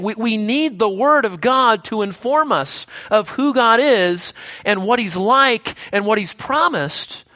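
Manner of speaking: ordinary delivery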